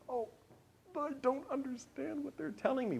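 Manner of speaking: high, soft voice of dismay; mock panic. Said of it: "This is terrifying".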